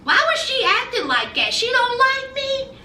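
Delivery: confused bride asking what happened, voice climbing; puzzled Jess. Retold or imitated. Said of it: "And Jess was like".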